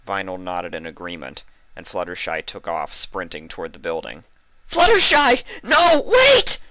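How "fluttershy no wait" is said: very high, loud desperate yell; yelling after her. A narrator's reading. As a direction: scream her name to stop her. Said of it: "Vinyl yelled after the Pegasus".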